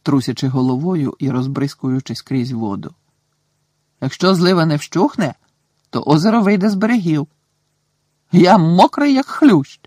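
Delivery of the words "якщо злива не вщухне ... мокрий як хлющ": put-on higher, louder complaining boy's voice; whiny soaked boy.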